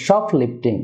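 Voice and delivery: শপলিফটিং with "SHOP" stressed. Shown as a demonstration. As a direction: lean on the start of শপলিফটিং. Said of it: "শপে stress হবে". (শপলিফটিং-এর "শপ"-এ জোর)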